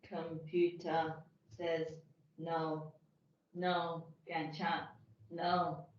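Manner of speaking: slow, low robotic monotone; flat machine voice, word by word. Pretend. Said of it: "you sound like a robot".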